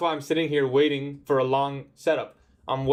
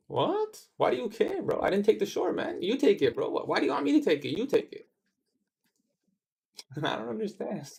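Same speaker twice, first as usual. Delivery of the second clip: exaggerated confusion; 7 semitones higher than usual. Mock-baffled reply to the chatter.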